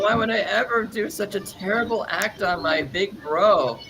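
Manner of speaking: hammed-up innocence to big bro; innocent act. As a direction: play the sweet little guy, pleading. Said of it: "did that whole little innocent stance".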